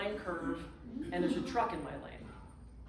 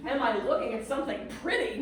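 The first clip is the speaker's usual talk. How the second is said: incredulous echo; 3 semitones higher, 4 dB louder than usual. Retold or imitated. Stranded traveller echoing the landlady in disbelief. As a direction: throw the question back in disbelief.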